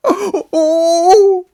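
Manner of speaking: high, loud delighted cry; shrill joyful oh. Acted. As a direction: burst out with joy. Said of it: "Colin cried out in delight".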